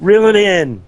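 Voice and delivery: high, loud comic order; high-pitched playful telling-off; mock scolding. Mock protest at politics topic.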